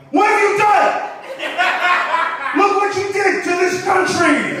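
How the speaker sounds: hammed-up high shout of horror; over-the-top furious scolding at full volume; outraged accusing yell. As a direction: scream the accusation, drawing out the words.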